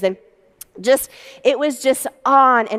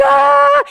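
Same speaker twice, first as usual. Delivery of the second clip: excited shriek; 7 dB louder than usual. Retold or imitated. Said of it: "and you're like".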